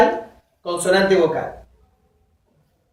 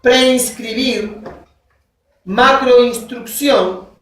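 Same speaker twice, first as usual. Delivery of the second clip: slow example words; much slower than usual. Shown as a demonstration. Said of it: "Por ejemplo".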